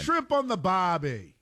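slow, high, playful mock Australian accent. Riffing with cohost on Australia, mock Australian accent. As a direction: drawl out the Aussie cliché for a laugh. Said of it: "That's an Australian accent".

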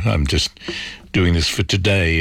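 normal talking voice

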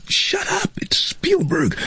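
mock stage whisper; urgent hush. Producers warning him behind the screen, urgent hush. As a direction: hiss a warning under the breath, imitating panic.

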